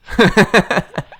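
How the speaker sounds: loud high-pitched giggle; high laugh. Real, not acted. Laughing at the joke.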